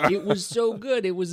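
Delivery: high, gushing excitement without the frog voice; excited gushing. Real, not acted. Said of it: "I would never attempt to best your Kermit the Frog".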